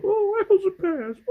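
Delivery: very high, loud cartoon Cockney; mock London accent. Seller mimicking locals she overheard, hammed-up London accent. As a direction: send up Cockney rhyming slang, squealed. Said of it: "but I did hear a few, like".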